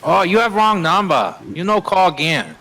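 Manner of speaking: mock broken-English accent. Answering a telemarketer in a mock broken-English accent. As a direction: play a confused foreign speaker brushing off a caller. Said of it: "we'd do it in a different accent".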